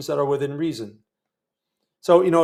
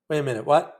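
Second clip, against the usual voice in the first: ordinary talk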